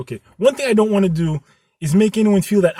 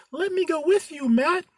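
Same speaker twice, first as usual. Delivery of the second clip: put-on girlfriend voice; 12 semitones higher than usual. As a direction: squeak it out like a nervous girlfriend. Girlfriend Julie voiced in story.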